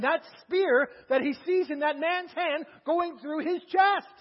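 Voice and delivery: ordinary talk, nothing marked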